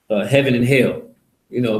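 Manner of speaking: ordinary talk, nothing marked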